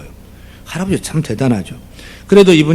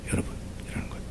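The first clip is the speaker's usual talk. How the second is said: hushed whisper of the point; sermon whisper; 15 dB quieter than usual. Acted. Pastor whispering the key point to the congregation. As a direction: drop to a breathy whisper for effect.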